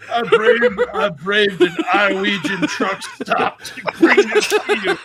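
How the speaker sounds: loud, high, laughing hard while talking; cracking up. Real, not acted. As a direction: force the words out through big laughter. Cracking up retelling the gift.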